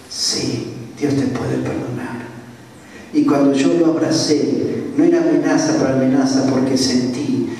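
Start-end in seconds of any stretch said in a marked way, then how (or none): 0.1-2.2 softer, gentle answer to a weeping man; soft reassurance. Retold or imitated. Preacher softly assuring the crying robber that God forgives, soft tender reassurance. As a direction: hush it down and comfort him.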